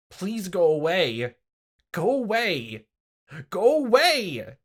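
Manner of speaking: from loud plea to high furious shout; building scream. Acted. Keiichi screaming at Rena, building to a shout.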